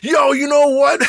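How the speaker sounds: high, loud mock yell; mock outburst. Imitating the annoyed lady venting, high mock yell. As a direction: ham up her fed-up blowup.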